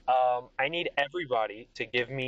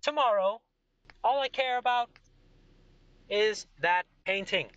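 high, proud, boastful king voice; arrogant king; 11 semitones higher than usual. Acted. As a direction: play the smug king who only cares about money.

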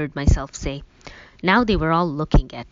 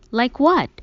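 higher, sharp frustrated snap back; frustrated retort; 8 semitones higher, 5 dB louder than usual. A narrator's reading. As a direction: throw the question back, annoyed. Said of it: "She sounded equally frustrated".

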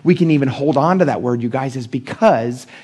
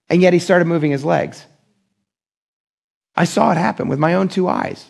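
ordinary talk

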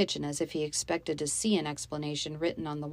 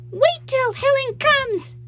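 put-on very high, loud child's scream; 10 dB louder, slower than usual. A narrator's reading. Little girl threatening her stepfamily, screaming. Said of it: "she screamed".

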